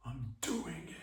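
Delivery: hushed whisper; quick secretive aside in a whisper. Pretend. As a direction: whisper it off the phone. Aside during the fake call.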